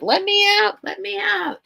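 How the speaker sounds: very high mock pet voice; pleading pet. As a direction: imitate a pet begging at the door. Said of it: "Now he's like".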